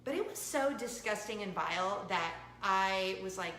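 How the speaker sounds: ordinary talk, nothing marked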